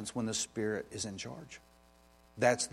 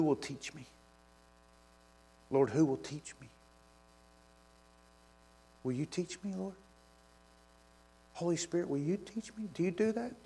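soft, higher pleading prayer to the Holy Spirit; hushed prayer; 3 semitones higher, 3 dB quieter than usual. Real, not acted. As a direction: quietly ask God to teach you. Prays softly to the Spirit.

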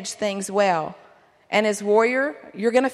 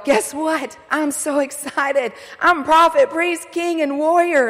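bursting with big news, higher pitch; excited announcement; 6 semitones higher, 4 dB louder, slower than usual. Pretend. Husband coming home to tell his wife, excited. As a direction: proudly announce it, building higher and louder.

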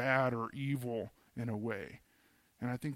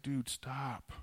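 casual God voice; 7 dB quieter than usual. Retold or imitated.

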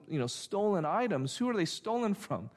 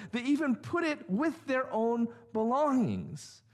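"even" hit higher each time, accusing list; repeated "even" raised and punched; stress on even; 7 semitones higher than usual. Shown as a demonstration. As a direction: lean hard on every "even" like a charge sheet. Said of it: "it would sound more like this".

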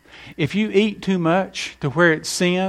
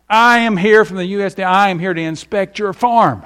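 puffed-up inspector raising his voice; pompous official; 5 semitones higher, 5 dB louder than usual. Acted. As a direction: throw your authority around. Officious agent shows his badge.

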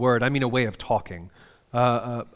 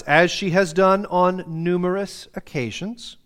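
ordinary talk, nothing marked

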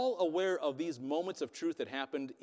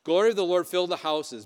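louder proclamation; 3 semitones higher, 10 dB louder than usual. Shown as a demonstration. Preacher urging his congregation to say it louder, full-voiced proclamation. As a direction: proclaim it much louder, ringing out. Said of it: "That's not enough. We gotta get a couple more".

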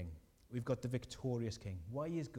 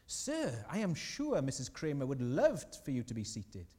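hysterical respect; 2 semitones higher, 5 dB louder than usual. Retold or imitated. Waiter to Kramer's guest, suddenly hysterically respectful. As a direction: gush with exaggerated, drooling deference. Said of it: "and hysterical respect".

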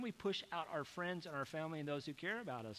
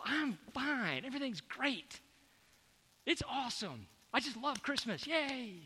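higher, bright mock-happy pretending to be fine; fake cheerfulness; 6 semitones higher than usual. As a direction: overplay being just fine, a forced front. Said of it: "you put this show on for them".

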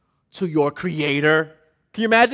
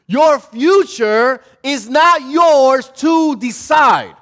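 slow, loud and high, every word hammered; preaching shout; 7 semitones higher, 7 dB louder, much slower than usual. Real, not acted. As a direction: shout it out to the congregation like a verdict. Preacher driving the point home.

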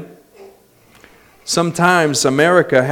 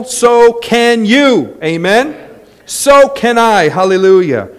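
higher, louder pulpit preaching; preaching at full force; 7 semitones higher, 5 dB louder than usual. Real, not acted. Pastor exhorting the congregation to stop complaining, at full force. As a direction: drive the point home to the church.

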